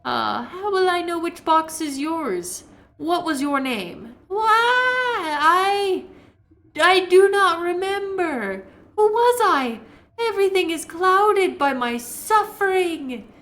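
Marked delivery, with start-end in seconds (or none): 4.3-13.3 high, slow, wailing ghost woman; wailing wraith. Acted. Wraith shrieking at the intruder, high wailing moan.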